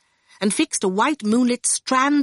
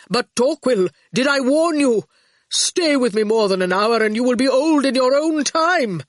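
lightly voiced character, pitched up; Hathaway warning; 5 semitones higher, 4 dB louder than usual. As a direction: give an emphatic, raised warning. Hathaway warns Torquil in the story.

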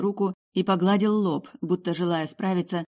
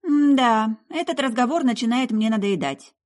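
high, loud drawn "hmm yeah"; annoyed young woman, voice raised; fed-up girl; 7 semitones higher, 6 dB louder than usual. Acted. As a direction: show you are losing patience.

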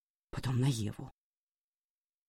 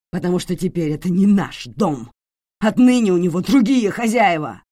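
much louder, enraged yell; furious shouting; 2 semitones higher, 9 dB louder than usual. Acted. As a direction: bellow at them in rage. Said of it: "Таким взбешённым его никто ещё не видел".

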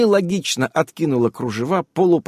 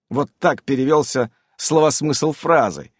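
ordinary delivery, nothing marked

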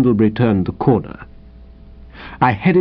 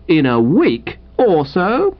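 slow, higher, disbelieving question rising at the end; incredulous echo; 4 semitones higher, slower than usual. Acted. Wooster echoing his unwelcome guest's answer, incredulous. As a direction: repeat it slowly in dismay. Wooster echoes the reply, appalled.

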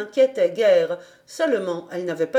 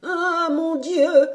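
put-on high, slow, quavering old woman's cry; a startled blind grandmother; old woman exclaiming in surprise; 8 semitones higher, 4 dB louder, slower than usual. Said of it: "dit une voix".

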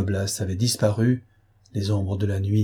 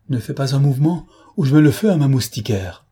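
loud threat; 4 semitones higher, 5 dB louder than usual. A narrator's reading. Marquise threatens with torch.

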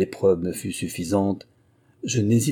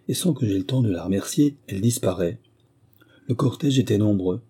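ordinary delivery, nothing marked

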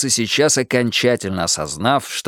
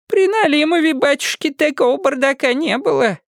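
put-on high wailing old woman; lamenting old woman. A narrator's reading. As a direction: grieve for the old days. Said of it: "какая-то старушка".

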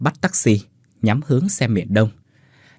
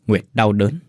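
usual speaking voice

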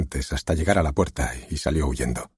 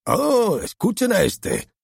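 high, scornful, mocking exclamation; scoffing director; slower than usual. Director scoffs at the author's claim, high and mocking. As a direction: sneer at the other man's word. Director mocks a pompous remark.

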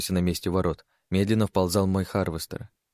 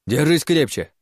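loud urgent shout; 7 semitones higher, 6 dB louder than usual. A narrator's reading. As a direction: yell it out to hold on. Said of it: "выкрикнул я".

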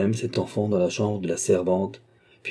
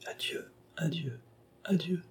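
very soft, higher-pitched goodbye; hushed farewell; 8 semitones higher, 11 dB quieter than usual. Acted. Sister embraces her brother in parting, very softly. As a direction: breathe out a tiny, spaced farewell. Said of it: "lui dit tout bas".

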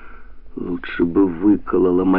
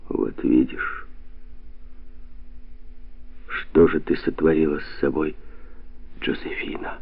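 hushed reproach; 3 semitones lower, 7 dB quieter than usual. A narrator's reading. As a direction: reproach her in a near whisper. Ghostly voice reproaches her.

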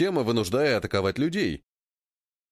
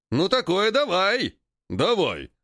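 ape fight cry; 6 semitones higher, slower than usual. Acted. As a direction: roar it, ready to brawl. Apes agree to fight. (громкий вызов на бой)

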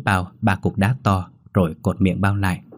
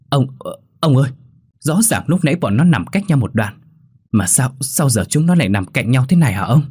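frightened; 5 semitones higher, 3 dB louder than usual. Acted. Servant frightened by moved corpses.

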